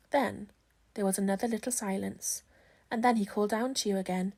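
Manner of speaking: plain reading voice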